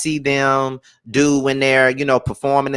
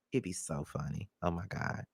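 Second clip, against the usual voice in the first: ordinary talk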